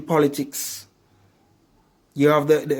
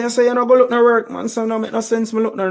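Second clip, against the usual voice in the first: mock patois argument; 8 semitones higher, faster than usual.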